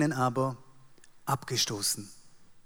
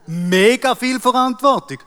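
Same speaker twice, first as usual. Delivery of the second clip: mock boast; 9 semitones higher, 10 dB louder than usual. Mock boasting about responsibility.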